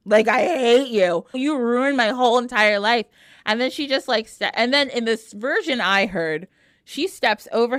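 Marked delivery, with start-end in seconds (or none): 0.1-3.1 louder, emphatic accusation; angry outburst. Acted. Medea tells Jason he ruined her life, angry outburst. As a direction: hit HATE hard and accuse him. Medea repeats it, building anger.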